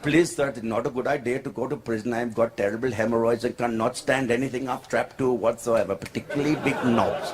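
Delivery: put-on low-pitched pleading Indian English accent. Indian driver begs to avoid prison, Indian accent. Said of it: "Indian guy in Dubai".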